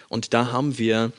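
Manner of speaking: ordinary talk, nothing marked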